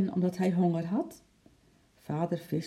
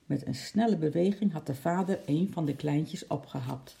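ordinary delivery

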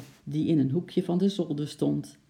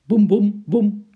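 loud, separate, higher booms; imitated booming thumps; 4 semitones higher, 9 dB louder, slower than usual. A narrator's reading. Storyteller imitates an apple bouncing downstairs, loud booms. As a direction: thump it out step by step.

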